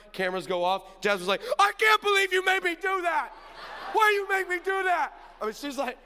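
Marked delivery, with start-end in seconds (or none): 1.6-5.1 imitating a high, loud, outraged shriek; mock furious screaming; furious daughter. Dad imitating daughter once cameras go off, furious shriek. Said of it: "so mad".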